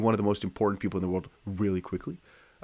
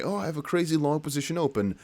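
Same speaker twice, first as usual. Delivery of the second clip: excited quote; 6 semitones higher than usual. Retold or imitated. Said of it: "get really excited and say".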